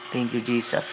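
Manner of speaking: soft, hushed thanks under the breath; hushed prayer. Acted. Preacher voicing Elijah's hushed thanks, softly. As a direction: murmur it like a private prayer.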